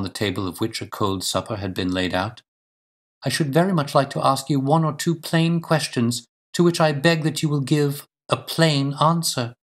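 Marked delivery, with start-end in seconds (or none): 3.2-9.6 higher-pitched lady, firmly pressing for answers; earnest woman's voice, raised in pitch. Acted. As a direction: play a lady making a direct, polite demand.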